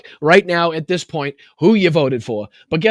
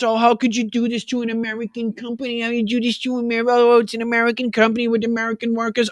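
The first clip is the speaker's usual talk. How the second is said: higher, whiny mock outrage; mocking the hypocrites; 4 semitones higher, faster than usual. Host mocking boycott hypocrites in a whiny put-on voice. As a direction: sneer out their fake patriotic shock. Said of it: "these fucking hypocrites".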